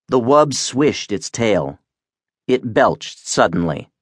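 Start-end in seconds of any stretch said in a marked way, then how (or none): none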